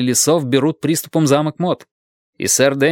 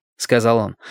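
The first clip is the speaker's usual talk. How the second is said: ordinary delivery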